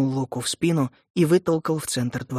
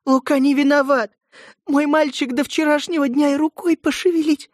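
pleading; 12 semitones higher, 4 dB louder than usual. Acted. Mother pleading before judge.

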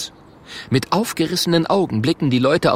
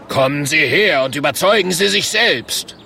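raised barker's invitation; showman's call; 6 semitones higher, 4 dB louder than usual. Acted. The Murk luring doubters to the cage, showman call. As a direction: call the crowd forward, loud and ringing. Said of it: "ruft der Murk".

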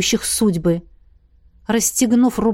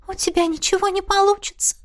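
high-pitched, sighing ghost voice; 9 semitones higher than usual. A narrator's reading. Ghost doubts the girl's efforts, sighing high. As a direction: sigh it out in a high voice. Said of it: "вздохнула Мисси".

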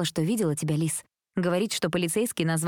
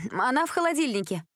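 called after her, raised; calling out; 6 semitones higher than usual. A narrator's reading. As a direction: call it after someone leaving. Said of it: "Последние слова женщина выкрикнула". (выкрикнуто вдогонку)

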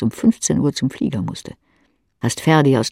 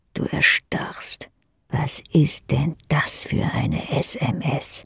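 stunned whisper; 4 dB quieter, slower than usual. Acted. Shocked by a text message.

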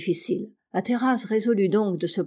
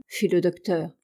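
usual speaking voice

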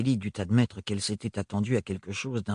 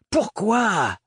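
sepulchral voice; 8 semitones higher, 4 dB louder than usual. Acted. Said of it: "plus sépulcrale que jamais".

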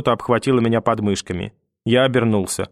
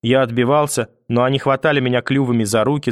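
ordinary delivery

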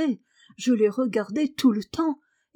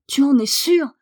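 loud, high astonished question; amazed, urgent questioning; crying out; 4 semitones higher, 7 dB louder than usual. A narrator's reading. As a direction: demand it in disbelief. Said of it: "cria Martha".

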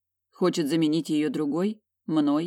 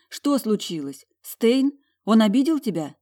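anxious questions climbing higher and louder; alarmed; 5 semitones higher, 5 dB louder than usual. A narrator's reading. Questioning her tearful sister, alarmed. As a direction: press for answers, fearing the worst. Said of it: "Страх мгновенно сковал сердце".

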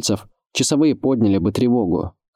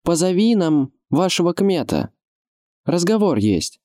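high, carrying noble request; sonorous request in raised pitch; 9 semitones higher than usual. A narrator's reading. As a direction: call up to the wall like a young lord. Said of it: "возвысил он звучный благородный голос".

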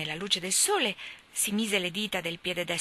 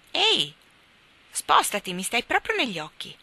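raised, higher voice telling her to move; little boy shouting to be let out of the glare; loud call; 5 semitones higher, 7 dB louder than usual. A narrator's reading. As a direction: call out loud across the nursery. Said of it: "disse John a voce alta".

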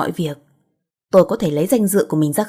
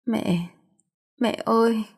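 crying; 3 semitones higher, 4 dB quieter, slower than usual. A narrator's reading. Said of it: "mà khóc lấy khóc để".

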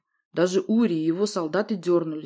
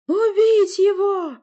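very high, loud, drawn-out squeal; shrieked order; 9 dB louder, much slower than usual. A narrator's reading. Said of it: "взвизгнула Власта".

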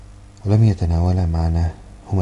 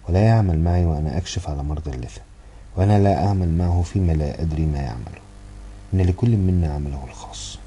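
ordinary delivery

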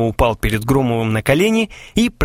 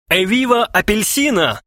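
high-pitched triumphant cheer; exclaimed; 9 semitones higher than usual. A narrator's reading. Klinkov celebrates the new magazine, crying out. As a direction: hail it grandly. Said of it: "вскричал Клинков".

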